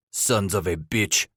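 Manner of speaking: put-on German trapper grumbling a curse in thick German accent. A narrator's reading. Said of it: "in his thick German accent".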